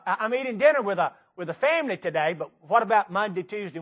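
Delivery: ordinary talk, nothing marked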